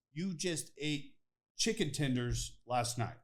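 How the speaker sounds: slow, each point laid out deliberately; slow pointed retort. Retold or imitated. Answering hunting critics, slow and pointed. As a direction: spell it out slowly to make the point.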